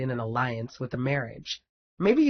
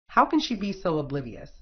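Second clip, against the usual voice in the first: plain reading voice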